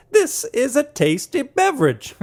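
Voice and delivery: high, slow tasting-note voice; hammed-up wine-taster praise; mock fancy connoisseur. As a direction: savor every word like a snob.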